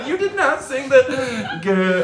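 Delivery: high laughing protest. Real, not acted. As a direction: laugh through the words. Laughing at missed lyric.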